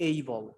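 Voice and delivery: "able" with a long "ay" at the start; native pronunciation. Shown as a demonstration. Teacher repeats the model for learners, "able" as "AY-bol". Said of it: "আমি কি বললাম যে".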